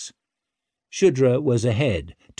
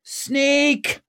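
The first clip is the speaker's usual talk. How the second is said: screamed sales cry. A narrator's reading. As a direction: scream it out to sell your wares. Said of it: "screamed a woman".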